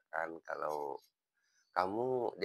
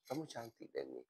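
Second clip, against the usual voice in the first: much softer, hushed sincere praise; tender compliment; 3 semitones higher, 12 dB quieter than usual. Retold or imitated. Teacher telling student Denny softly that she is beautiful, tender. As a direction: say it softly like a quiet promise.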